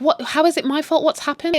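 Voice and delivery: high, confused protest; mock bewilderment. Joyce doesn't even know, mock bewildered. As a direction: imitate someone baffled at being blamed. Joyce blamed without knowing.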